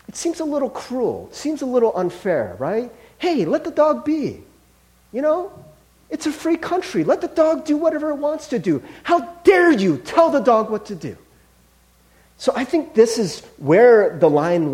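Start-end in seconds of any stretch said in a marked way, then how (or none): 3.2-11.1 indignant objector. Retold or imitated. Mock objector defending the dog.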